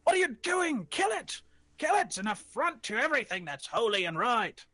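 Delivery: high, urgent mob demand; furious mob. Acted. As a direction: demand they kill it. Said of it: "they say".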